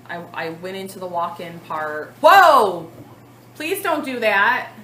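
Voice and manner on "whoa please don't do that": sudden loud startled cry, then a firm plea; startled by the dog, cry out then scold; startled whoa. Real, not acted. Talking to the camera, startled by Archer, sudden loud cry. Startled by pet mid-story.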